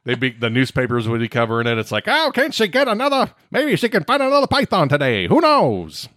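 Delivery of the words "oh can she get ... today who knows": put-on high, excited old-time radio announcer; old baseball announcer. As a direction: call it like a vintage ballgame broadcaster. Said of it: "trying to do an old baseball announcer voice".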